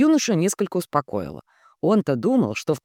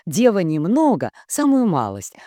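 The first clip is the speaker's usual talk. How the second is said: usual speaking voice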